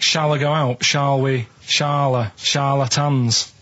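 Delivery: slow breakdown of charlatans; sounding out. Shown as a demonstration. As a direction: spell the word out, stretching "charlatans". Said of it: "it's like".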